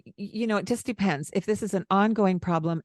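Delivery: ordinary delivery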